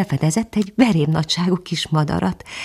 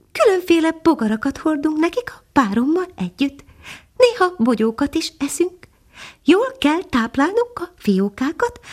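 much higher, small bird voice; a little hidden bird answering from the branches; tiny nightingale; 12 semitones higher than usual. Acted. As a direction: speak as a tiny, gentle songbird.

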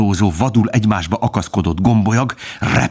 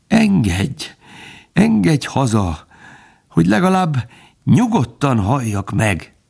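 higher-pitched, drawn-out begging; pleading; 7 semitones higher than usual. A narrator's reading. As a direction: plead humbly to die in peace. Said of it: "Halkan és könyörögve beszélt". (könyörögve, alázatosan)

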